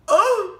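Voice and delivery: high sharp horrified gasp; shocked gasp. Real, not acted. Comic gasp at her own loud stomach noise, shocked gasp. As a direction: gasp in horror.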